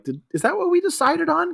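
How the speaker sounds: high, befuddled put-on voice; puzzled Nat Faxon. Nat Faxon questioning the plan name in a high confused voice. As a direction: sound lost and confused. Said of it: "Nat Faxon is like".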